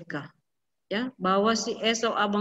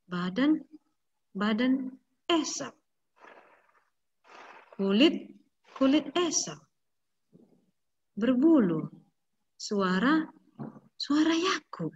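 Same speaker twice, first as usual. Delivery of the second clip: soft, hesitant wondering with long pauses; quiet puzzled musing; 5 dB quieter than usual. A narrator's reading. Blind father feeling his son, muttering softly to himself. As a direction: feel your way through each word, unsure.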